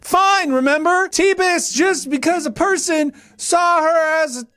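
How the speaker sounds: very high, strained rant; mock yelling. Host reading an irate comment, mock yelling. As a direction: yell it in a high, hectoring voice. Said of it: "You don't have to yell at me".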